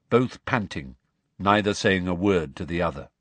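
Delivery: usual speaking voice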